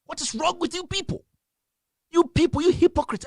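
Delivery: high-pitched, exasperated accusation; angry berating. Real, not acted. Berating the audience.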